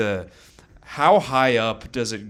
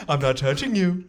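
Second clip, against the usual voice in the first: higher, teasing sing-song; childish taunt; 6 semitones higher, 3 dB louder than usual. Acted. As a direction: needle them like an annoying kid. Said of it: "a little nan and a boo boo".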